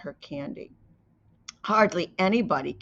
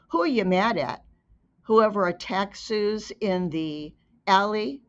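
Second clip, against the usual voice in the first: ordinary delivery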